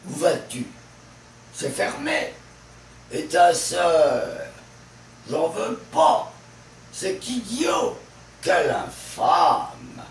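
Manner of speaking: last syllables "tu", "mé", "sœur", "pas", "diot", "fâme" punched loud; stress on last syllable. Shown as a demonstration. Teacher demonstrating French accent to learners, final syllables like "sœur" and "pas" hit hard. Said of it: "L'accent est mis sur la dernière syllabe".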